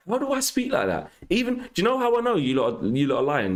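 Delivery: very high-pitched, indignant protest; indignant disbelief. Real, not acted. Streamer denying chat's claim about his voice, indignant. Indignantly disputing how chat says he speaks.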